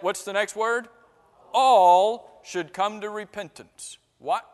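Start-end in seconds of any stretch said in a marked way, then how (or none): 1.5-4.0 "All" drawn out long and loud, then easing; stress on All. Shown as a demonstration. Preacher supplies the congregation's next word, stressing "All". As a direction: land hard and slow on "All".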